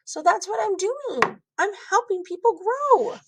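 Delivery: put-on high, self-satisfied kid; pleased with his own logic; proud child. Child justifying his honesty.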